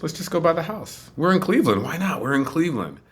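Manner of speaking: high-pitched, urging enthusiasm; acted-out spontaneous eagerness; imitating eager friends. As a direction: talk them into an outing.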